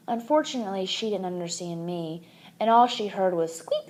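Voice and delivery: ordinary delivery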